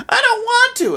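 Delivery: put-on very high babyish whine; Shinji. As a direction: refuse like a hammed-up little baby. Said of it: "he's just such a baby".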